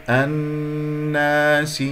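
الناس slow, with a long hummed ن; full ghunna. Shown as a demonstration. Teacher modelling the correct reading, full ghunna. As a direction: hold the nasal ن in الناس, no stress after. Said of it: "النون مشددة أكمل ما تكون".

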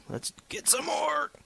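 high, slow, put-on cheer; mock hero cry. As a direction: sing it out like a cartoon hero. Excited mining in a game.

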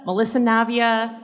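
ordinary talk

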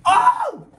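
very high sudden scream; piercing high yelp; terrified shriek. Real, not acted. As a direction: shriek in fright. Rat jumps at him.

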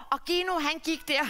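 plain reading voice

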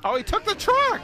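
high, loud disbelief; shocked yelp. Real, not acted. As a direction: cry out as the truck is taken. Truck stolen in game.

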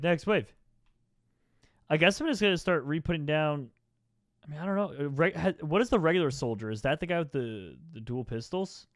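ordinary talk, nothing marked

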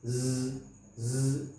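ज़ as a voiced buzzing z, low. Shown as a demonstration. Teacher showing students ज़ slowly, buzzing z. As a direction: demonstrate ज़ with tongue vibration. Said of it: "तो देखिए".

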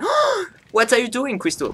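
high, drawn-out mock-shocked mum in English; English mother. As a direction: play a mother startled by the screaming. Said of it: "elle est anglaise".

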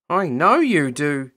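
a touch of a frown in the voice; mildly disapproving. A narrator's reading.

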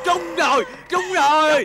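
high, elated shouting; jubilant cry. Acted. Husband rejoicing at the bird's promise of gold, jubilant. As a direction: whoop as if you hit the jackpot.

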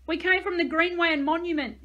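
loud, high pleading yell; desperate yell. A narrator's reading. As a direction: yell it to be believed. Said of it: "he yelled".

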